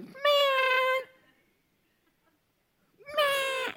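very high, drawn-out exasperated sigh word; exasperated man. Retold or imitated. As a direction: stretch it up high in disbelief. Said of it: "I think he looks at".

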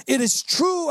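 climbing high on "true," a pleading cry; dying declaration. Retold or imitated. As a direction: lift "It is true," up high, begging to be believed. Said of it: "they go".

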